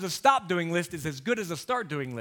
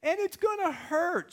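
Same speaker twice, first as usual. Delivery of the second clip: put-on much higher, whimpering little-girl voice; scared daughter; slower than usual. Daughter telling dad her fear, whimpering child voice. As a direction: whine it like a frightened child.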